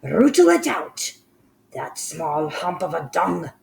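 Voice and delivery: high, loud screech. Acted. Grand High Witch screeches at the assembly, high and loud. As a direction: screech the order furiously. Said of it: "screeched the Grand High Witch".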